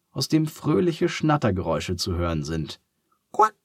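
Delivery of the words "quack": short bright duck quack. A narrator's reading. Duck's first quack.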